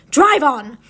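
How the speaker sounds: loud, curt order; witch's command. A narrator's reading. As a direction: call out the order imperiously. Witch barks order to her driver.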